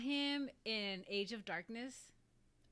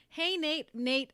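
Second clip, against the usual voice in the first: loud, raised call of greeting; 7 semitones higher, 10 dB louder, slower than usual. Real, not acted. Vlogger greets someone she was just talking about, calling out. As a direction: hail someone loudly. Greets Nate who turns up.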